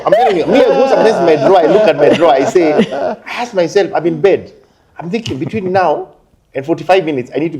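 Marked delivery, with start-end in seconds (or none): 0.1-3.9 high, loud, laughing storytelling; cracking up mid-story; laughing while talking. Real, not acted. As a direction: tell it through fits of laughter.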